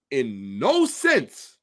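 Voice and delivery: "no" stretched long and slow; drawn-out emphasis. Shown as a demonstration. Lecturer stressing the absolute claim, slowly. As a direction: lean hard on "no", very slow. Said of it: "to exacerbate the term".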